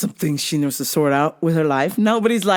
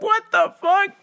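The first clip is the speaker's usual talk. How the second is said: shocked disbelief. Retold or imitated. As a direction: shriek it in shock. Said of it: "were like".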